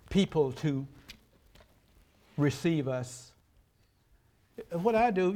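ordinary delivery

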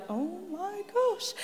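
stunned hush. Retold or imitated. Classmates staring at her, slow hushed shock. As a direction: breathe it out slowly, stunned.

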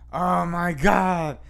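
slow, drawn-out French "Oh my God"; a teacher swooning in a French accent. Retold or imitated. French teacher exclaiming at a student, slow French accent. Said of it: "she would say it in French".

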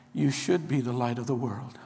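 quiet wrong version. Shown as a demonstration. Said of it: "I want you to know what it doesn't say".

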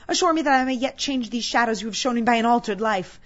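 loud, high, anguished begging; desperate pleading. Acted. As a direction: cry out for mercy in mounting terror, then implore. Scrooge begs at his grave.

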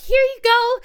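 high, singsong little quote; breezy handback. Retold or imitated. Imitating the assistant handing back the photo, breezy. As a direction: chirp it lightly as if all is fine.

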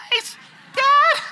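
put-on very high, loud laughing cry of a kid; laughing squeal. Pastor joking the pulpit fits only short people, squealing like a kid. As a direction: squeal it like a child calling out.

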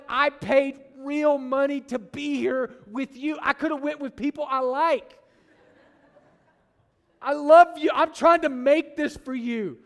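a fed-up parent pleading, rising to a loud cry of love; exasperated parent. Retold or imitated. Parent at Disney pleading with a complaining kid, exasperated.

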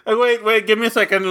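usual speaking voice

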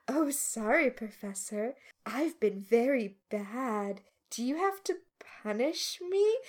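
soft, mock-coy southern accent; hushed, teasing imitation of a southern accent; sexy southern accent. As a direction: purr it quietly in a put-on southern accent. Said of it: "doing the weirdest southern accent ever".

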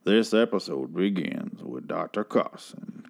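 low, slow, drawn-out intro voice; slow put-on announcer. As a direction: stretch every word out, low and slow. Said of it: "talk like that".

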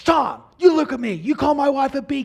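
high, loud furious yell over the fence; angry yell. Retold or imitated. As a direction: bellow it like an enraged neighbour shaking the fence. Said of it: "the neighbor's yelling this whole time".

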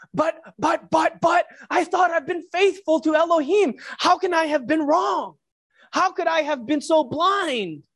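panicked self-doubt pouring out; distressed Saul. Acted. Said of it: "He's struggling here".

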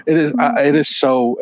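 ordinary talk, nothing marked

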